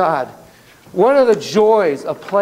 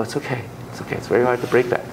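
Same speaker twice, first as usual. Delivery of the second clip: reassuring; 4 semitones lower, 5 dB quieter, much faster than usual. Retold or imitated. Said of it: "And I'll go".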